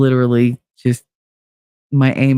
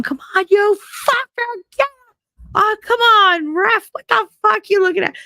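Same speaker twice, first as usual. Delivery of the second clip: much higher, yelling at the TV wrestling match; screaming at the ref. Retold or imitated. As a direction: scream at the ref like your guy is losing. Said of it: "Like, I'm just screaming".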